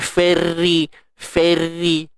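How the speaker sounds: Ferry said with a flat, spread fa like English ferry; flattened fa, wrong. Shown as a demonstration. Ustaz mocking the wrong flattened fa. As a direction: squash the vowel into ferry. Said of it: "jangan fa penyek pula".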